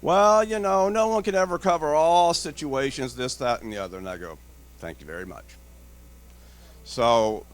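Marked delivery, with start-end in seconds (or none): 0.0-4.0 higher, louder mock hedging; hammed-up evasive salesman; evasive vendor. Vendor dodging indemnity. 4.8-5.5 softer, lower dry thanks; quiet sarcastic brush-off; dry dismissal. Retold or imitated. Dismissing the vendor.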